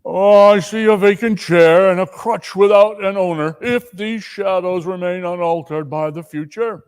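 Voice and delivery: a grave, foretelling voice; solemn spirit. Acted. Ghost warning Scrooge of the boy's fate. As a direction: pronounce it like a prophecy.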